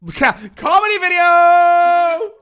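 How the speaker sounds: loud high yell of "comedy video"; mock announcement. Streamer loudly declaring a comedy video, high shout. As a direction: burst out with it like a hype announcer.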